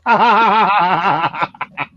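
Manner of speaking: long, steady ha-ha-ha cackle; hammed-up scheming laugh; mock villain laugh. Comic plays a plotting Canadian, mock evil laugh.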